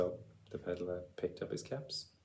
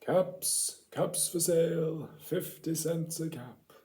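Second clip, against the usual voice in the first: peddler calling out; 5 semitones higher, 4 dB louder than usual. A narrator's reading.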